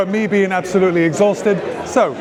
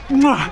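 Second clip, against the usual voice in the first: blown kiss; 5 semitones higher than usual. Real, not acted. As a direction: blow a kiss to the crowd. Blowing kisses to the city.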